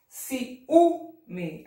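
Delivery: Ciúme with a long, punched Ú; stress on Ú. Shown as a demonstration. Teacher sounding out the accent, strong Ú in Ciúme. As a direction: break up Ciúme and hit Ú. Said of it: "acento agudo".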